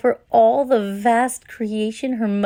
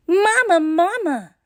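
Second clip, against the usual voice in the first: calling out; 8 semitones higher than usual. A narrator's reading. Girl running to her mother, calling out. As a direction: call out eagerly for mama. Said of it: "Maimuna cried out".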